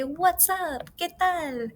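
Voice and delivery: high, bright friendly hello to a pal; friendly casual greeting. Acted. Teacher voicing Daniela greeting a friend casually, high. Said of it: "since they're friends".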